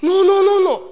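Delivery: imitated foreign teacher's emphatic correction; correcting teacher. Teacher corrects his pronunciation.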